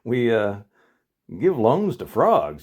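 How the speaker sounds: lightly voiced teller turning him down; bank teller. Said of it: "She says".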